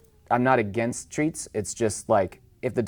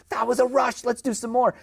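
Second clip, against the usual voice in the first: much higher, giddy dog voice; voicing the dog; 13 semitones higher than usual. Dog back from the park, high giddy voice. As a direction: play the hyped dog begging for more. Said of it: "it's like".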